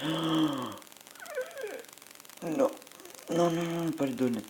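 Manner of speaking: real panic as his cell gets eaten, voice jumping up; panicked gasp. Real, not acted. Player about to be eaten in game.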